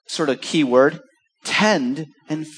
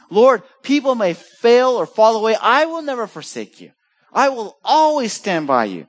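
Peter's boast; 8 semitones higher, 4 dB louder than usual. Retold or imitated. Peter's boast voiced in sermon.

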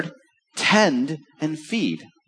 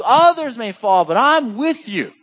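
Peter's boast; 9 semitones higher, 5 dB louder than usual. Retold or imitated. Peter's boast voiced again.

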